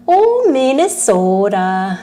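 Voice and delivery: slow, drawn-out mock Minnesota accent; terrible Minnesota accent. Streamer teasing Minnesota viewers, terrible Minnesota accent. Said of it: "That was my terrible, uh, Minnesota accent".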